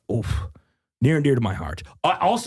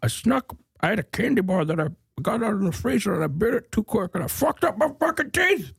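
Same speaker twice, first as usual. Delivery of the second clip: put-on higher, cranky old man voice; old neighbor; 9 semitones higher than usual. Griping about his teeth as a grumpy old neighbor. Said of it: "you sound like an old neighbor".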